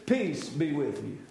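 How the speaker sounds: Jesus calming every fear, low and gentle. Retold or imitated. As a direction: speak the peace tenderly. Jesus speaking peace to circumstances.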